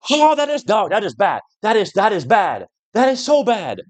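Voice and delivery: high, whining outrage, pitch way up; exasperated wail. Real, not acted. Gamer wailing at a terrible puzzle, high exasperated whine. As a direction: wail it in disbelief like the game wronged you.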